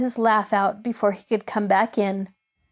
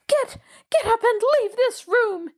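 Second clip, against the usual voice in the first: much higher, stammering order to leave; angry cry; 13 semitones higher than usual. A narrator's reading. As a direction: housekeeper sending the child out. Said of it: "she cried".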